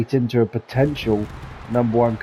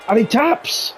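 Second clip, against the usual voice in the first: high excited finish call; 11 semitones higher than usual. Real, not acted. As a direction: call the tap-out with a jump in pitch.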